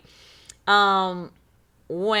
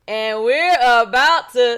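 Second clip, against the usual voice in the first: calling out the show name; 5 semitones higher, 9 dB louder, slower than usual. Real, not acted. Show catchphrase called out.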